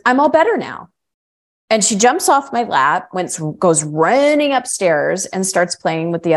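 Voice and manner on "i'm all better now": put-on high, bright little girl bouncing back; little girl's voice. Retelling the friend's daughter jumping up cured, in a high childlike chirp. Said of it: "and she goes".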